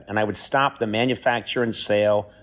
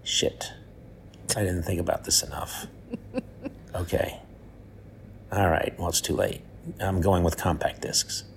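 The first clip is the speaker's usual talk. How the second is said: quiet; 5 semitones lower, 7 dB quieter than usual. Real, not acted. Deflated self-correction.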